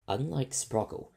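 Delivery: usual speaking voice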